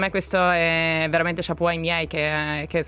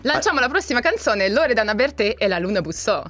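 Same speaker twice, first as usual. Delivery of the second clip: radio host; 4 semitones higher than usual. Pretend. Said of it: "ha una passione per la radio".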